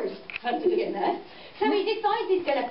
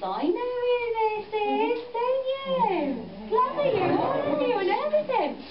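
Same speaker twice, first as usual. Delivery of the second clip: high wise woman voice; 10 semitones higher than usual. Acted.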